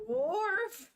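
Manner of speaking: "Wharf!" yelped high and loud like a cartoon dog; mock dog bark. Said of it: "But like".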